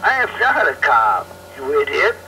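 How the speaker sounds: ordinary delivery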